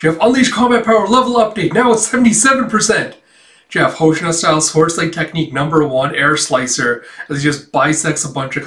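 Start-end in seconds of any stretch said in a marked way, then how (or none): 0.2-3.2 high-pitched, excited alert voice; power-level announcement. Retold or imitated. Announcing the suit's power level update, high and excited. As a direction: imitate a system calling out a level-up.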